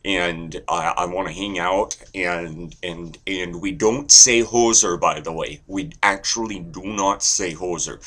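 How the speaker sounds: friendly, lively Canadian chatter; Canadian accent. Shown as a demonstration. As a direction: ramble amiably in a Canadian accent. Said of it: "then there's Canadian".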